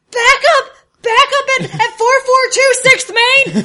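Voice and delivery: shouted, high-pitched call for backup; panicked radio call. Acted. Greg squawks over the police band for backup, shouting. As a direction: yell it urgently into a police radio. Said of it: "he hears the, the squawk of, of Greg".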